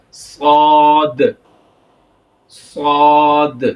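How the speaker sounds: صاد drawn out long and loud, heavy s; heavy saad. Shown as a demonstration. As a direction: stretch صاد with a full thick s. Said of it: "اس کو ہم سواد نہیں پڑھیں". (صاد موٹا کر کے، کھینچ کر)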